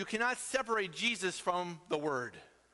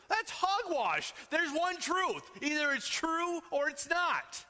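higher, slow and emphatic; 8 semitones higher, slower than usual. Real, not acted. Pastor rejecting relative truth, slow emphatic declaration. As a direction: hammer it home, slow and high.

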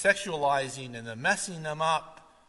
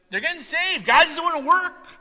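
much higher, bright insistence; excited pastor; 11 semitones higher, 9 dB louder than usual. Retold or imitated. Pastor defending converts to deacons, high and excited. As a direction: lift it high with joy.

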